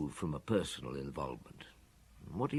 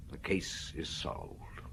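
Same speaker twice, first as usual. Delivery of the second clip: slow whisper, softer; quiet certainty; 6 semitones lower, 8 dB quieter, much slower than usual. Acted. Maigret telling Lapointe the case is solved, slow whisper. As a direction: let it out slow and hushed.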